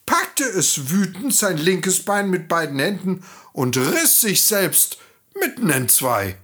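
ordinary delivery, nothing marked

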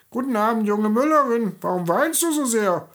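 high, piping little-man voice; fluting little man. Acted. The little man greets the crying miller's daughter in a high fluting voice. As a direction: flute the greeting like a tiny sly goblin. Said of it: "flötete das Männlein".

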